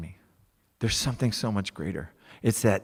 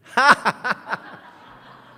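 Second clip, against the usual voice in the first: high joyful laugh; 10 dB louder than usual. Real, not acted. Joyful laugh after the affirmations.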